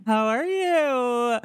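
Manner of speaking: high, long-held greeting; singsong greeting. Acted. Host greeting a guest from Australia, singsong and drawn-out. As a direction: stretch it out high on the last word.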